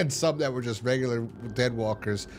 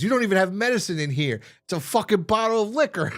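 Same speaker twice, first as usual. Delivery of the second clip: higher, louder outraged outburst; angry accusation; 9 semitones higher, 5 dB louder than usual. Retold or imitated. Daryl confronting Bob over the liquor, angry. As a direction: act out an indignant confrontation.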